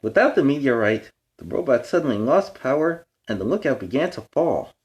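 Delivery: bright, storybook read-aloud; reading to children. A narrator's reading. Reading a picture book aloud to young fans. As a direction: read it like bedtime to little kids. Dramatic narration.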